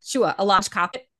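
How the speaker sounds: put-on playful Boston accent, dropping the r's; attempted Boston accent. Guest teasing Bostonian host with a mock Boston accent. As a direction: have a rough go at a Boston accent. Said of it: "I can't do a Boston accent, but that's my best shot".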